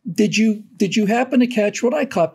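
usual speaking voice

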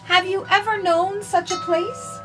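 usual speaking voice

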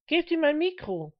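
high, loud instruction; calling out. A narrator's reading.

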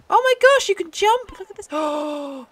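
high, loud burst of real excitement; excited discovery. Real, not acted. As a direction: blurt it out, thrilled and high-pitched. Delighted discovery of jumping in game.

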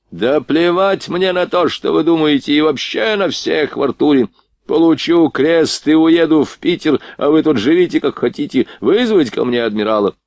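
high-pitched, contemptuous outburst; imperious drunk prince, defiant. Acted. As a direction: brush off the subordinate and give orders. Voices the irascible drunk prince.

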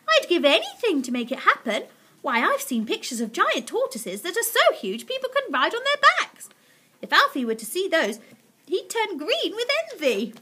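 high, loud gushing; eager crying out. A narrator's reading. Missus Silver longing for a huge tortoise, excited high cry. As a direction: exclaim with mounting enthusiasm. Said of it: "Missus Silver cried".

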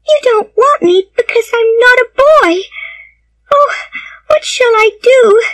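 put-on very high-pitched, wailing little girl; distraught child's cry. A narrator's reading. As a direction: wail it as a heartbroken child. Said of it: "cried the child suddenly".

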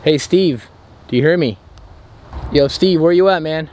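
ordinary talk, nothing marked

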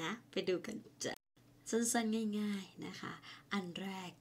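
ordinary talk, nothing marked

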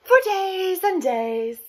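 high, loud, slow stretch on "days"; drawn-out. A narrator's reading. Narrator shows children how long it took, drawn-out. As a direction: draw out "days" like endless waiting.

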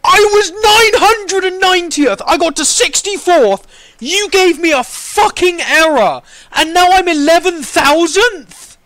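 high, loud wailing rant; outraged disbelief. Real, not acted. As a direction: yell in anguished outrage at the game. Sent back to eleven thousandth.

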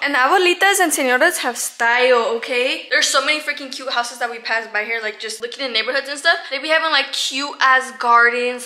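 ordinary delivery

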